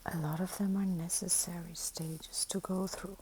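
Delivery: hushed whisper. Real, not acted. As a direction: keep it to a whisper. Soft whispered musing.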